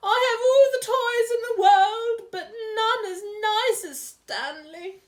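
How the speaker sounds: high, loud, wailing sobs; crying queen. Acted. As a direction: cry it out like a spoilt queen. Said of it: "started crying harder than ever".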